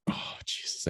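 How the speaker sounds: breathless whispered oh Jesus; whispered dismay. Retold or imitated. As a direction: whisper it like you're dreading what comes.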